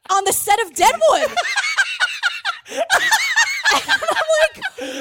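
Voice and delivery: words dissolving into high laughter; cracking up mid-sentence; giggling in disbelief at the twist; laughing. Real, not acted. Cracking up at the absurd story.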